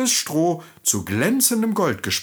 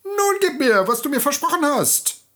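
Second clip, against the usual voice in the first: high, squeaky little man demanding what is owed; forderndes Männlein; 13 semitones higher than usual. A narrator's reading. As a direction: play a pushy little goblin, pitched way up. Männlein fordert das Kind. (forderndes, hohes Männchen)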